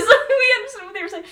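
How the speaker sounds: ordinary delivery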